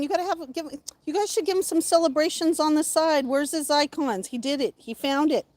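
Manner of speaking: ordinary talk, nothing marked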